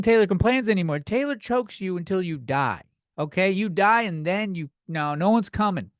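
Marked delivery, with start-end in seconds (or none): none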